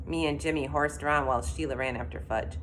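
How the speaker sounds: ordinary delivery, nothing marked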